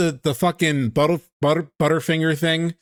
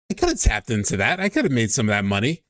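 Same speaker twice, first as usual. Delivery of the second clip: ordinary talk, nothing marked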